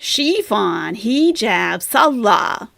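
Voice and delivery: Chiffon, hijab, salah as SHEE-fon, HEE-jab, SAL-lah; mock a learner's first-syllable stress in Chiffon, hijab, salah; mispronunciations. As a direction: list Chiffon, hijab, salah with each opening syllable overstressed. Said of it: "it's all incorrect".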